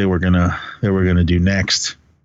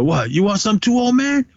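much higher, mocking bully jab; retold tough-guy taunt, pitched way up; Debo taunting; 11 semitones higher than usual. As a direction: needle the old man like a playground bully. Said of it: "Debo went".

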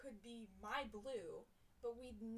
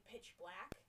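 ordinary talk, nothing marked

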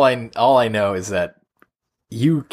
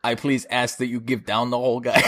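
put-on Arnold accent; 4 dB quieter than usual. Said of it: "let Arnold come in".

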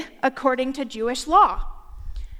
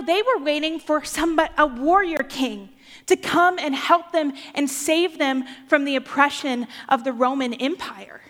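usual speaking voice

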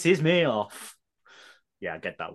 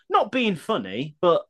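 high, drawn-out quoted phrase; mock preamble; 5 semitones higher, slower than usual. Host reading out a listener's hated phrase, mock preamble. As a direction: lift the pitch and stretch it, rising at the end.

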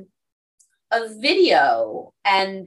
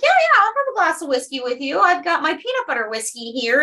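higher and fast, breezily offering; breezy mock cheer; 6 semitones higher, faster than usual. Host breezily offering peanut butter whiskey to Scotsman, quick and higher. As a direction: toss it off casually, a touch higher. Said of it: "I was like".

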